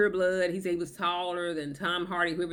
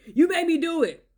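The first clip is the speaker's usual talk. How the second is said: mock blaming; 8 semitones higher, 5 dB louder than usual. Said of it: "they'll say".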